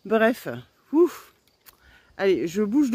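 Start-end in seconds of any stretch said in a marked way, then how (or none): 0.9-1.3 loud high relieved puff; relieved ouf. Real, not acted. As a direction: blow out a big ouf of relief. Relief on leaving the spot.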